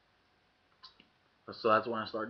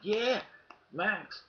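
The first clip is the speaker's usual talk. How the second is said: excited call; 12 semitones higher than usual. Retold or imitated. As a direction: call out, bursting to show off. Showing off a new trick.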